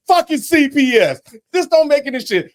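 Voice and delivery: high, worked-up rant; going off. Retold or imitated. Husband going off at the TV about CPS, high and heated. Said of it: "I'm going off".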